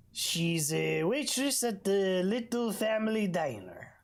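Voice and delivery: normal talking voice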